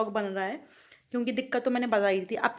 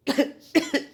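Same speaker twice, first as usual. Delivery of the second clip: cough; 5 semitones higher, 7 dB louder than usual. Real, not acted. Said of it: "बोला नहीं जा रहा है".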